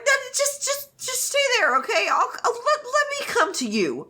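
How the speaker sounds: high coaxing. Retold or imitated. Wei Wuxian coaxing, high voice.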